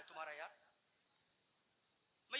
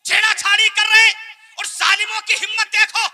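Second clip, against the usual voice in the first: loud, high, declaiming sermon voice; full-force preaching; 9 semitones higher, 21 dB louder than usual. Acted. Preacher mocking a rival sect's claims to his congregation, full force. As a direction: hurl each phrase out to the crowd.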